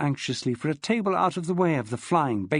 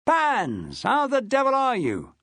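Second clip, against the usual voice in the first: hearty hail; 9 semitones higher, slower than usual. Acted. Maxwell greeting hungover Pansy, hearty. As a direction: hail her across the corridor. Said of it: "hailed her".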